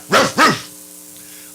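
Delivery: light dog barks; barking. Acted. Reluctant son grumbling on his way to field, barking. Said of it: "the one was like".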